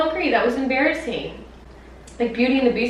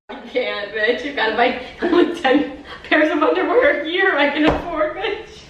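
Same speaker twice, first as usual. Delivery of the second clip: talking through laughter; words breaking into giggles; laughing; 6 semitones higher than usual. Real, not acted. Laughing at her own rant.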